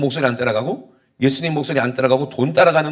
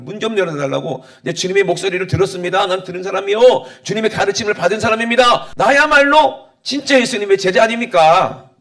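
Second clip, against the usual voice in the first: protesting would-be disciples; 6 semitones higher, 6 dB louder than usual. Acted. Shut-out believers insisting they are true disciples, protesting. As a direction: plead your case, climbing higher and louder. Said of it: "라고 생각하는 사람이에요".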